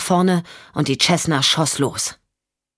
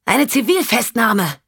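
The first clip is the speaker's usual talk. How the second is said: higher, slow, pointed announcement; Michelle, triumphant; 6 semitones higher than usual. Acted. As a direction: savour the arrest slowly. Michelle announces a citizen's arrest.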